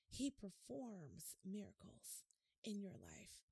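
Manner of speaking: slow, soft whisper; whispered promise. Real, not acted. Preacher whispers a promise of miracles to listeners. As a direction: let it land in a whisper.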